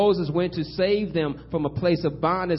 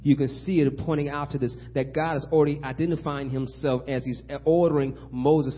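ordinary talk

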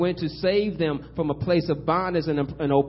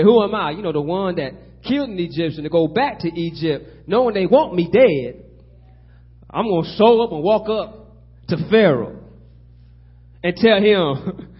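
louder pulpit voice with punched words; preaching; 4 semitones higher, 10 dB louder than usual. Acted. Preacher voicing Moses objecting to God, forceful and incredulous. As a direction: preach Moses' protest, hitting key words hard.

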